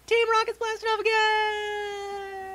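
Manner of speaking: high, slow, hammed-up shout; fly off like a cartoon villain; Team Rocket catchphrase.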